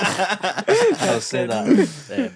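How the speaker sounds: high laughing, drawn out. Real, not acted. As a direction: laugh through the words slowly. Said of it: "laughing through".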